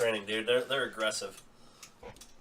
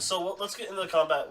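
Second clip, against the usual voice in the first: usual speaking voice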